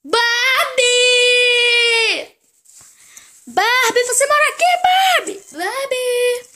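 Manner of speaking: high, loud repeated calling. Acted. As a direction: shout her name down the street. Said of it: "vou sair gritando o nome dela". (chamando a Barbie aos gritos)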